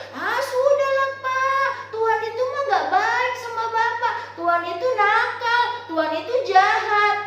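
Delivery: high, loud, whiny complaint; angry wife. Acted. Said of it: "ibunya marah".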